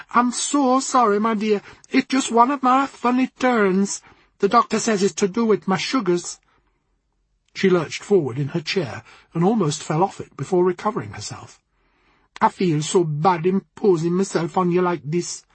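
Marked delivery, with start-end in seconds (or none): 0.1-6.4 put-on higher, lilting Jamaican accent. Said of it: "in a rich Jamaican accent". 12.4-15.4 put-on light Jamaican accent, apologetic; a hint of Jamaican lilt. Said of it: "in a rich Jamaican accent".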